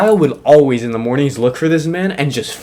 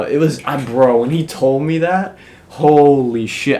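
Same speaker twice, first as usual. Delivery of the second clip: normal talking voice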